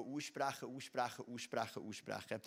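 steady murmured repetition, trailing down; fading repetition. Shown as a demonstration. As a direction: let each repeat sink away. Repeats the word as ongoing practice.

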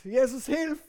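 loud call to Jesus; cry for help. Acted. Sinking Peter calling to Jesus, loud cry for help. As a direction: shout for rescue while sinking. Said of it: "schreit zu Jesus".

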